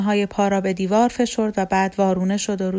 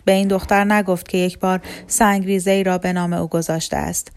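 ordinary delivery, nothing marked

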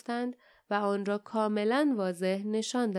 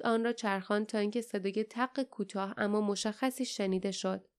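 usual speaking voice

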